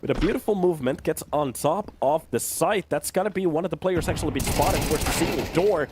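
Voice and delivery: excited play-by-play calling; esports caster. Acted. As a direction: call the action fast and hyped. Live play-by-play of the round.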